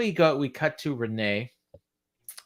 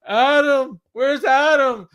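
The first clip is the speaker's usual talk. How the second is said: high, loud mock scream for Adam; imitating a scream; 13 semitones higher, 8 dB louder, slower than usual. Retelling the injured wrestler calling for Adam, mock screaming. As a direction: wail for your friend like a hammy dying man. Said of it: "unconscious and screaming".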